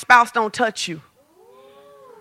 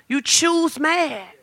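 You choose mad, slow and much softer; quiet repeat; 10 dB quieter, much slower than usual. Shown as a demonstration. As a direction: drop almost to a murmur.

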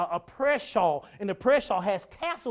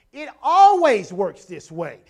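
emphatic declaration; 11 dB louder, slower than usual. Real, not acted. Preacher stresses principle.

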